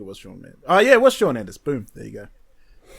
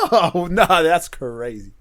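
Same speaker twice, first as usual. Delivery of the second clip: laughing; 5 semitones higher, 8 dB louder, slower than usual. Real, not acted. Laughing at a friend's opinion.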